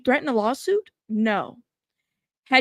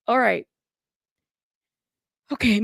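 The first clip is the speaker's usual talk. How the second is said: ordinary talk, nothing marked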